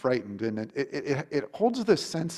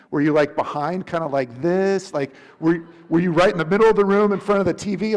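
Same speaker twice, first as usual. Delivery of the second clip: louder, rising, rushing eager questions; excited questions; 5 semitones higher, 8 dB louder than usual. Pretend. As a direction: pepper him with excited questions, faster and louder. Imagined eager questions to Jesus.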